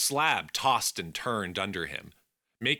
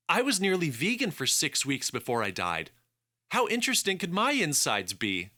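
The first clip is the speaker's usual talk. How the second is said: higher, wry sidekick voice; higher companion voice; 8 semitones higher than usual. Acted. Alex jokes about his insides.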